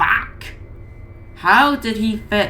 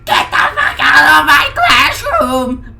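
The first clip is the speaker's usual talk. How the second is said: much higher, much louder slow shout; furious scream; 10 semitones higher, 8 dB louder than usual. A narrator's reading. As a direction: scream him out of the room.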